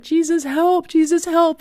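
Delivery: high-pitched frantic prayer, retold; desperate plea. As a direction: reenact a panicked cry for help. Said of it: "it was like being electrocuted".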